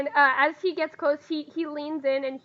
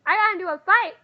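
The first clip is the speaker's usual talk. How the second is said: put-on louder, higher child's outburst; excited little boy; 5 semitones higher, 6 dB louder than usual. Muddy child tells Morin about a fight, excited blurt. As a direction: blurt it out like a small kid.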